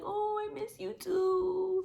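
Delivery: high, slow cooing; tender reply. Retold or imitated. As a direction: coo it back like to a small child. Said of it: "And I'm like".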